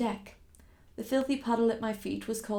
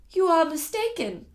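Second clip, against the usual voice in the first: trying to sound cool; 7 semitones higher, 5 dB louder than usual. Acted. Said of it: "I tried to speak coolly, but my voice shook".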